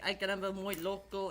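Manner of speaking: usual speaking voice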